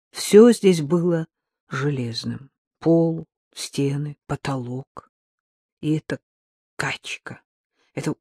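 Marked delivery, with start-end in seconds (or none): none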